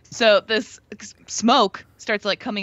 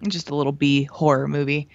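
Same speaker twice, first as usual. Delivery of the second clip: ordinary talk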